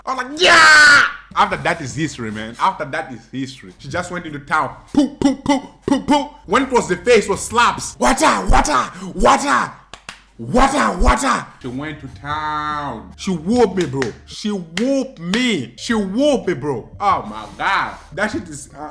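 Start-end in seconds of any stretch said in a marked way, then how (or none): 0.4-1.0 high, loud yell; mock pain cry. Child cries out when punched. 4.9-6.2 high, loud repeated puffs; imitated punch sounds. 8.0-11.5 high, loud kung-fu style yells; mock slap cries. 14.3-15.7 "She whooped me" slowly, each word stretched; drawn-out emphasis. Real, not acted. Dwells on the beating.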